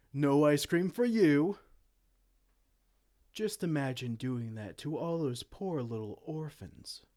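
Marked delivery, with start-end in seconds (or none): 0.1-1.6 gloating denial. Acted. Denying the chancellor ice cream.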